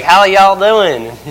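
loud, high, drawn-out greeting; country preacher imitation. Preacher greets the visitors, in a hammed-up drawl. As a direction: stretch it out like a hearty country preacher.

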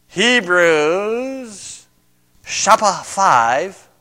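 slow, put-on Japanese accent. Shown as a demonstration. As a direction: drawl it in a mock Japanese accent. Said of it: "That's my Japanese, uh, accent".

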